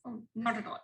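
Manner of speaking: usual speaking voice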